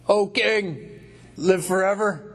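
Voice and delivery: high, sustained acted call; voice from the cavern. Daniel answering the king from the lions' den, high cavern call. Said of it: "from way down there echoing in the cavern".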